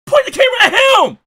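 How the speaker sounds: high, fast yelled imitation of the clip guy; mocking the clip. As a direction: squeal the line like the guy in the clip. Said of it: "He's like".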